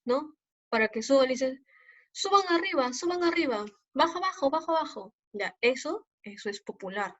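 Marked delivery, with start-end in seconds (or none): 2.1-5.1 mock collector call, higher, chanting; bus fare collector. Teacher imitating a bus collector calling riders, higher. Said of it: "los cobradores ... dicen".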